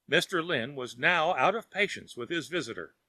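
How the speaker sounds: ordinary delivery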